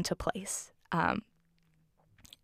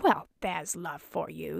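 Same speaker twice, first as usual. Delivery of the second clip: a hint of a grumbling parrot character; slow, lightly put-on voice for a talking parrot; lightly voiced parrot head; 3 dB quieter, slower than usual. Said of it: "the parrot head is like".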